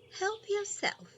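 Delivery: a kindly grandmother answering, lifted up; Grandma's voice. Acted. Grandma voiced in read story.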